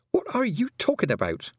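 high-pitched, baffled question; frowning bafflement. A narrator's reading. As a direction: demand an explanation, pitch jumping up. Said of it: "said Tiffany, frowning".

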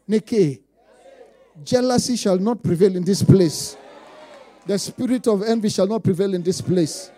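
loud preaching proclamation; forceful pulpit decree; loud declaration. Acted. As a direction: command it over the congregation. Declaring against jealousy and envy.

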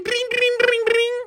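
very high trilled "bring, bring"; bicycle bell imitation. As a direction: squeal out repeated bell rings. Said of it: "he's ringing the little bell".

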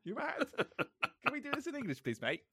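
high-pitched imitation Scouse; hammed-up Scouse bloke; strong Liverpudlian accent. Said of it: "Really strong Liverpudlian accent".

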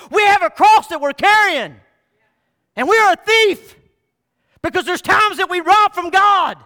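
full-voiced preacher's call; preaching shout. Real, not acted. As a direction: drive it home to the congregation. Preaching climax.

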